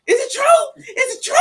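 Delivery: high, loud, desperate question; frantic cry. Retold or imitated. Daughter pulls in the driveway asking if it is true, frantic. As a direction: cry out for confirmation, pitch way up. Daughter arrives crying out, retold.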